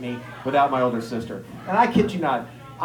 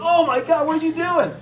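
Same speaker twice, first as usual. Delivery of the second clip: put-on shocked kid; 12 semitones higher than usual. Brother doubled over from punch.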